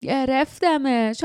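a guard's rough victory cry; rough shout. A narrator's reading. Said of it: "صدای خشنی فریاد زد".

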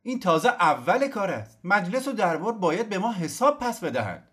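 high, loud defiant shout; old general shouting his demands. Acted. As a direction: shout it at the crowd. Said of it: "سردار فریاد زد".

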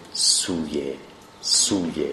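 سوی as "suu-ye", low and soft; long vowel. Shown as a demonstration. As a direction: repeat سوی with a long oo in the first syllable. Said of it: "در محل اجرای بلنده".

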